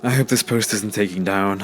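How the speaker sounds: ordinary delivery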